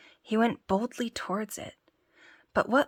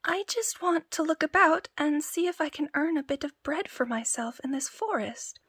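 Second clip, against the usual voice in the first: higher, timid whimper; whimpering tailor; 8 semitones higher than usual. Acted. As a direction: plead nervously to the giant. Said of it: "The tailor whimpered".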